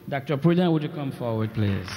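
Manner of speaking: ordinary delivery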